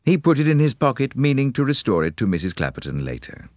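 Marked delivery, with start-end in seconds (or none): none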